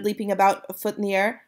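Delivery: ordinary delivery, nothing marked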